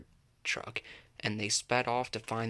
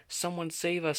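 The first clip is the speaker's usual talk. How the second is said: much higher squeaky plea; storybook squeak; 6 semitones higher than usual. A narrator's reading. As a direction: squeak it out like a small scared friend. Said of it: "squeaked Amy".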